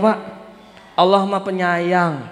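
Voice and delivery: ordinary delivery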